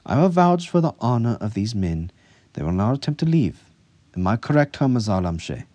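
high, loud, firm vouching; forceful assurance. A narrator's reading. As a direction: vouch firmly, challenging the officer. Asserts authority, challenges the officer.

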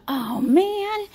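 high, loud mock dismay; thought aloud. Retelling her thought about the apron, high and loud. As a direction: squeak it out like a small letdown. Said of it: "I thought".

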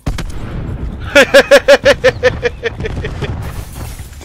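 breathy burst of laughter. Real, not acted. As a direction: laugh out loud, caught off guard. Risa tras aviso del juego. (risa a carcajadas)

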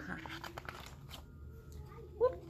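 ordinary delivery, nothing marked

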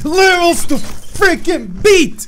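high, slow, straining rant; growing exasperation ending in a groan; frustrated outburst. Real, not acted. Player venting at Jimmy over levels, frustrated. Raging at repeated failures.

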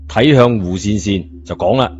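usual speaking voice

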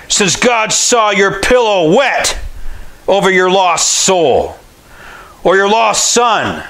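ordinary delivery

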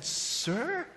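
high, soft incredulous questioning; baffled waiter. Acted. Waiter scratching his head questions the customer, baffled high voice. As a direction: stammer softly, rising in disbelief. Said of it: "waiter is scratching his head and said".

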